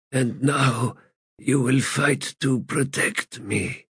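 lightly voiced, weary, bitter god; a hint of a broken ancient god; frail god voice. Crippled god speaks.

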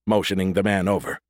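usual speaking voice

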